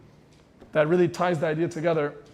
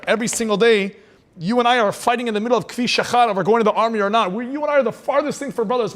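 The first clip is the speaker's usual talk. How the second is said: higher, louder exasperated protest; heated cab driver; 5 semitones higher, 5 dB louder than usual. Acted. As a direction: push back hard at the rabbi, voice climbing. Cab driver argues with the rabbi.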